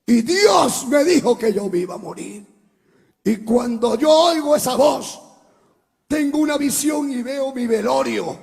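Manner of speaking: loud, high preaching with the music; fiery preaching. Acted. Preacher pouring out his vision of death to the church. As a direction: build the vision to a cry.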